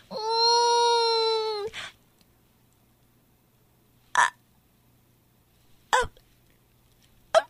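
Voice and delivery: barely audible voiceless croaks; lost voice. Acted. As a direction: try to speak with no voice. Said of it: "这种小小的声音啊".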